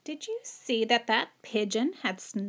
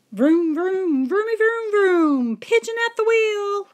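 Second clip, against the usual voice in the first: much higher, loud playful vroom; excited driving noises; 10 semitones higher, 8 dB louder than usual. Pretend. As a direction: zoom like a kid playing car. Pigeon pretends to drive.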